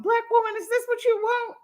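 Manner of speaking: high-pitched mock rant; a light parody of his yelling; imitating the mad man. Streamer retells the man's rant, high-pitched mock yell. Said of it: "He was talking about".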